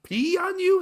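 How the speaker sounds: very high, slow disbelief; horrified at the idea; appalled. Real, not acted. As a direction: friend recoiling at being peed on. Disgusted at a hypothetical.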